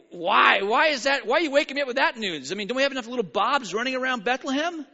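loud, rapid, high exasperated protest; annoyed shout. Acted. A sleeper woken at night snaps at the shouter. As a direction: yell back, fed up at being woken. Woken villager complains at the door.

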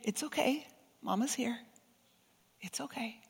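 mother soothing her crying daughter, hushed; tender comfort. Pretend. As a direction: hold her close and murmur it. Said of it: "I wrap her in my arms and I hold her close".

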